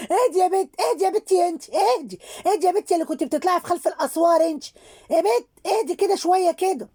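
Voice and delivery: high-pitched scolding, telling her off; scolding calm down. Real, not acted. Vlogger telling off Um Fatira, high scolding.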